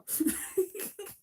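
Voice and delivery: short, high burst of laughter. Real, not acted. Laughing at herself for missing Joe's easy fix. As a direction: laugh at yourself.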